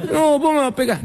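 put-on high whiny child voice with a laugh; whining kid. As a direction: plead like a frightened boy. Kid pleads with mother.

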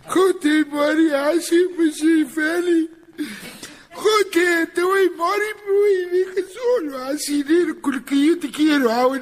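comic fake crying at a wake; mock wailing. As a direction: wail over the coffin like a drunk. Drunk wailing over the coffin.

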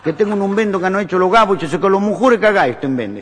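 put-on high, eager Italian-accented pitch; Italian husband. Husband telling wife of gaucho invention, Italian-accented eagerness. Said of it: "Y la llama a la mujer".

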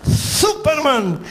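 drawn-out, grand reveal of the name, slow; dramatic hero announcement. Retold or imitated. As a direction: stretch the name out like a big entrance. Announcing the hero's arrival.

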